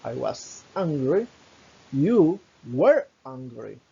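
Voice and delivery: slow, deliberate, each word apart; slow teacher demonstration; slow model sentence. Shown as a demonstration. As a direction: model it slowly for the class. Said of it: "Sería".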